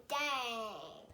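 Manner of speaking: ordinary delivery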